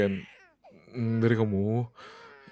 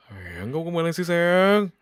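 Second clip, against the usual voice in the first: high, rising plaintive question, swelling louder; exasperated plea; 6 semitones higher, 3 dB louder, slower than usual. Acted. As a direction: plead with an absent wife, voice climbing. Frustrated husband calling absent wife. (mengeluh gemas dengan nada makin tinggi)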